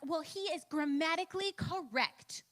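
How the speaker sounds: retold defensive comeback to a gentle correction; defensive retort. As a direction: dig in and defend yourself, slowly. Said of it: "you get defensive when someone calls you out".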